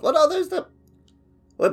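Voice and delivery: ordinary talk, nothing marked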